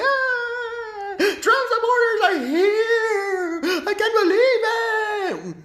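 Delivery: very high, laughing-crying mock scream, slow; mock hysterical shriek. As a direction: shriek like a horrified starlet, hammed up. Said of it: "They're gonna be like".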